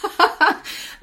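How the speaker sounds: short, high laugh; high laugh of relief from tears; emotional laugh. Real, not acted. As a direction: let out a laugh after nearly crying. Moved listener laughs after near tears.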